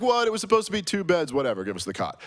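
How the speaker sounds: high, loud mock outrage, fast; pretending to be put out for Rob; hammed-up fake indignation that fades to a shrug; faked upset. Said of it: "pretend like I was a little bit upset".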